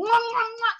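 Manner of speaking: high, loud smacking mwah noises; exaggerated smooching sounds; imitated kisses. Comedian kissing her idol's cheeks, exaggerated kiss noises.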